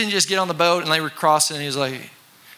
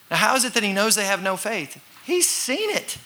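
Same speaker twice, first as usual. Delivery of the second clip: ordinary talk, nothing marked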